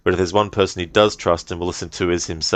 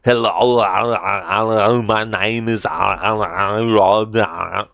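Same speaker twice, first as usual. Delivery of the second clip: exaggerated Austrian accent, words stretched into long groaning noises; slow, hammed-up Austrian accent, wailing between words; over-the-top Arnie accent; 2 semitones higher, much slower than usual. Shown as a demonstration. Showing how not to talk normally, exaggerated Arnie accent. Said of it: "I wouldn't be going like".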